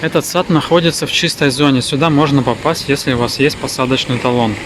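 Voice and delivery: ordinary talk, nothing marked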